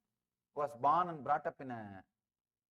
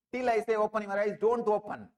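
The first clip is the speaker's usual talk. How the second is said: louder, firm order to keep eyes shut; commanding thief; 5 semitones higher, 8 dB louder than usual. Retold or imitated. Thief to the seated seeker, loud and firm. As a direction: bark the order and slip away.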